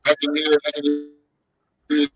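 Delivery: normal talking voice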